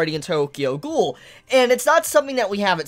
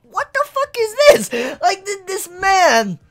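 higher, slow, laughing disbelief; shocked audience; 11 semitones higher, 4 dB louder, slower than usual. Retold or imitated. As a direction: cry out, baffled and cracking up. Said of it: "you're like".

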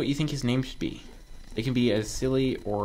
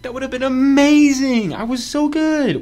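worked-up high-pitched yell; excited yelling; 11 dB louder than usual. Real, not acted. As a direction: shriek it with stretched key words. Exclaims over near success in game.

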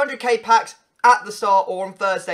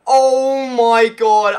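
frustrated outburst; 8 dB louder, slower than usual. Real, not acted. Frustrated by missed chance.

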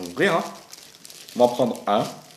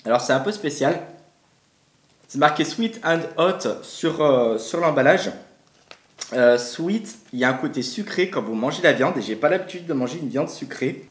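talking between bites; chewing while talking. Real, not acted. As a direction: mouth partly full. Seen 1: Put jerky in mouth at -4s; jaw works while talking throughout.

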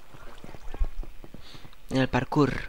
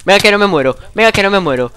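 excited; 5 semitones higher, 7 dB louder, faster than usual. Real, not acted. Pushing through fire.

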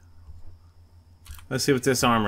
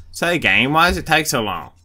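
louder, exasperated complaint; 6 semitones higher, 6 dB louder than usual. Real, not acted. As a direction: call out at the game, fed up. Impatient at slow loading screen.

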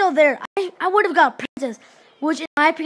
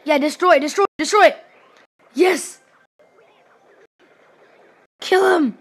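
loud, urgent battle cheering; excited, forceful commands; egging on; 2 semitones higher, 6 dB louder than usual. Real, not acted. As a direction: urge the troops on loudly. Urging on game troops.

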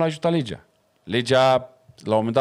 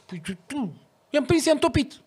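much higher, put-on voice of the eager cop; mock officer protesting; 10 semitones higher than usual. Traffic officer answering his boss, high mock protest. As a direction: whine it up high, defending yourself. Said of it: "Șeful lui vine și zice".

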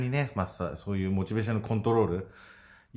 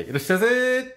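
high, loud shopkeeper welcome; shop greeting; 7 dB louder, faster than usual. Pretend. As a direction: sing out like a shop clerk. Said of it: "これ言いたいだけかもしれないですね". (店員風の元気な呼び込み)

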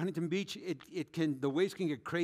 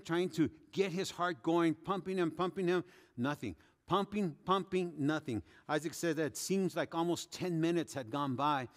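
ordinary talk

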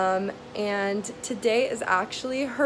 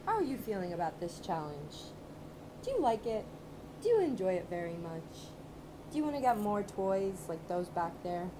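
hushed, tender pet talk, much softer; soft cooing to the dog; 9 dB quieter, faster than usual. Real, not acted. Owner talks softly to her dog.

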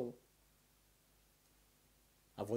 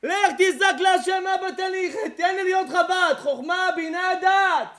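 higher, louder mocking rant; fiery preaching with laughter; 10 semitones higher, 15 dB louder than usual. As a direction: tear into the lovesick young man. Preaching at full force.

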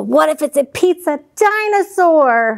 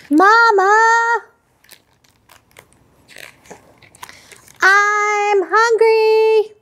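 put-on whiny hungry child; 6 semitones higher, much slower than usual. Child Vivian calls to her mother.

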